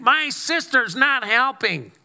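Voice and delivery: high, slow whiny voice; Martha's complaint. Retold or imitated. Martha whining to Jesus about her sister, high and slow. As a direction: whine like a frantic sister. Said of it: "now you've completely lost context".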